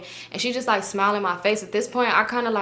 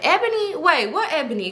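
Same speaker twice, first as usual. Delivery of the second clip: high-pitched imitated disbelief; surprised; 8 semitones higher than usual. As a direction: act out a girl startled by a name. Girl reacts to the name.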